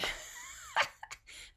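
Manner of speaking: high-pitched real laugh; real giggling laugh; amused laugh. Real, not acted. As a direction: burst into a high laugh at your own remark. Laughs at own firefighter remark.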